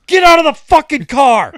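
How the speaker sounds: full-volume imitated furious yell; trooper losing it. Trooper orders a mouthy driver out of the car, furious yell. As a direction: scream the order, voice shooting way up. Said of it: "Fucking loses it".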